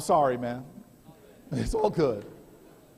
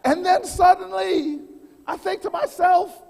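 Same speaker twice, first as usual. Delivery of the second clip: preaching build; 8 semitones higher, 8 dB louder than usual. Acted. Speaker rallying the audience, full-force preacher's cry. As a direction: preach it to the crowd, rising. Rousing speech opening.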